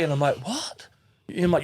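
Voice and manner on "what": high, soft questioning squeak; retold puzzled reaction; mock bafflement. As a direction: act taken aback, hushed and high. Acting his baffled reaction in a story.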